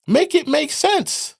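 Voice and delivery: exasperated. Real, not acted. Ranting viewer demanding sense, loud and exasperated. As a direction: throw it out in disbelief.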